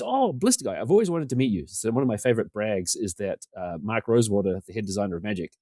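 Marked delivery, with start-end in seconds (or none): 0.0-1.7 high-pitched, delighted recognition; acted-out happy surprise, pitched up; delighted greeting. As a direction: imitate someone thrilled to finally meet you. Famous designer greets him, acted out.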